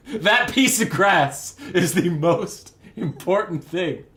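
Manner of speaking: loud, slow, cracking up mid-line; laughing mock insistence. Captain obsessed with a patch of grass, laughing insistence. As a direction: insist absurdly while fighting laughter.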